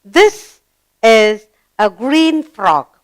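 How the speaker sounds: deliberate classroom reading; read-along model. Shown as a demonstration. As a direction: stretch each word for learners to follow. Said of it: "let's read".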